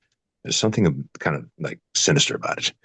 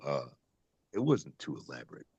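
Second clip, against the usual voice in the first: ordinary delivery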